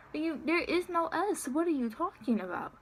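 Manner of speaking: high-pitched, exasperated disbelief; mock-baffled protest; incredulous retort. Acted disbelieving retort to manager.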